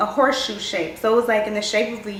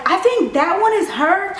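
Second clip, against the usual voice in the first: high, loud blurt across the table; loud high outburst; shouting across the table; 7 semitones higher, 4 dB louder than usual. Retold or imitated. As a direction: call it out too loud to the whole table. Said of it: "I must have shouted across the table".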